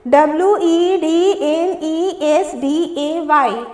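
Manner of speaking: slow letter-by-letter reading; spelling out. Shown as a demonstration. Teacher reading the tenth word's spelling, spelling out. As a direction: spell it slowly, every letter separate. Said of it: "ten number में पढ़ते हैं".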